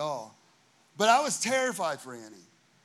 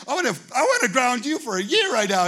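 higher, louder fed-up outburst; mock exasperated rant; 7 semitones higher, 10 dB louder, faster than usual. Pastor venting inwardly at Annie, louder exasperated rant. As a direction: vent it like an irritated youth pastor.

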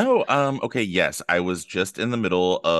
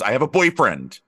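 announced loudly; 6 semitones higher, 4 dB louder than usual. Retold or imitated. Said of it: "You have to be like".

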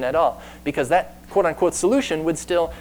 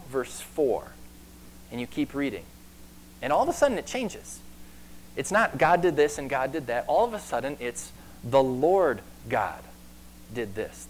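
usual speaking voice

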